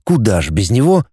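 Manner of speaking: ordinary delivery, nothing marked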